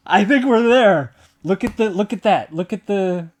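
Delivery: high, loud, thrilled disbelief; real elation. Real, not acted. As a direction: stretch the words in giddy triumph. Celebrates getting the picture right.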